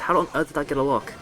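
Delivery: ordinary talk, nothing marked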